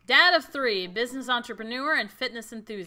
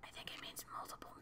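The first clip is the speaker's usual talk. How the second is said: whispered aside; 7 semitones lower, 23 dB quieter than usual. Real, not acted. Confused aside about an unknown term.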